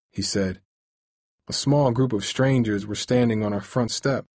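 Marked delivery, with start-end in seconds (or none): none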